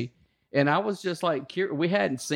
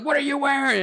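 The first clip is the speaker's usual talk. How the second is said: much higher demanding voice; mock drill instructor; 11 semitones higher, 3 dB louder than usual. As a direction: demand it in a high bark.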